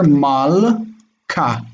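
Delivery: "mal, ka" in two separate beats with a hard k; broken into syllables. Shown as a demonstration. Teacher showing the broken syllable, "mal, ka" split with a hard k. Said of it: "we have a broken syllable here".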